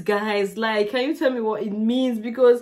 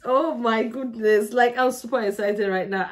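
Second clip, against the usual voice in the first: usual speaking voice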